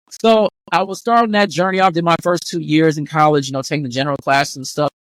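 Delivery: ordinary talk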